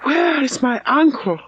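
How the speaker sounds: high, urgent cry for her uncle; anxious cry. A narrator's reading. Elizabeth cries out for her uncle in alarm. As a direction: call out in sudden distress. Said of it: "cried Elizabeth".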